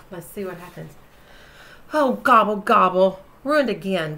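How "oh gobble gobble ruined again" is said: groaned. Acted. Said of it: "groaned Turkey".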